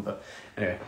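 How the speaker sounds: ordinary delivery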